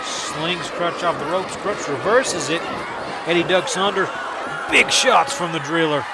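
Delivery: live wrestling play-by-play, rising to shouts at the finish; wrestling commentator. Acted. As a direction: call the match as it happens.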